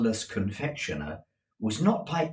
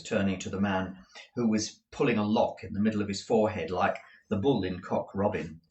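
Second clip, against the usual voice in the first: usual speaking voice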